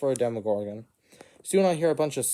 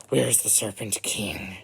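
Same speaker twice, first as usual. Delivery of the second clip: slow, low monster voice; Drowned Lord voice; 3 semitones lower, slower than usual. Acted. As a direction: drag it out like a sea monster. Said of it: "says the Drowned Lord".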